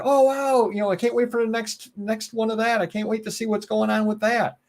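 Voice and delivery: high-pitched imitation of an excited viewer; eager fan. Host imagining ad viewers hooked on a character, mock-eager. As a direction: play an audience hooked on the next episode.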